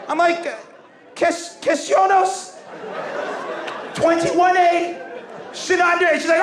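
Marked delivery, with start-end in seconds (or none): 1.1-6.2 high, slow, laboured pretend French; bluffing fake French. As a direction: stretch out each made-up word, pitched right up. Pupil bluffs French at teacher.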